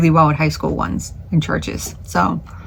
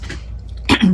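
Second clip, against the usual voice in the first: throat clearing; 4 dB quieter than usual. Real, not acted. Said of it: "as I'm choking for no reason".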